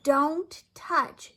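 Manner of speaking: put-on big sister shouting at the baby; shouted. A narrator's reading. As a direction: snap it out as a bossy big sister. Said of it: "shouted D W".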